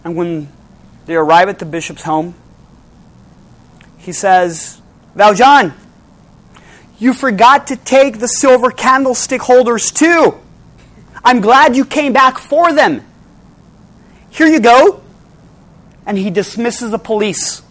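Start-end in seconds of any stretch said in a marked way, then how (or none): 5.2-15.0 loud, high, raised voice; calling out. Acted. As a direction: call out heartily as the bishop. Bishop calls out welcome before police.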